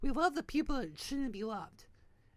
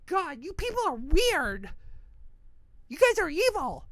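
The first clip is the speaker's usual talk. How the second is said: loud, higher, exasperated accusation; angry outburst; 5 semitones higher, 7 dB louder than usual. Retold or imitated. Telling off the museum's online followers, angry outburst. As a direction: lash out at them, voice raised. Ranting at the community he quit.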